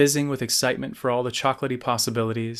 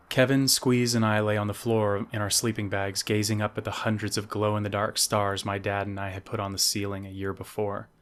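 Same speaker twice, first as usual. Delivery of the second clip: usual speaking voice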